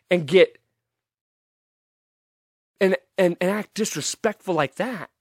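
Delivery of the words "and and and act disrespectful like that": ordinary delivery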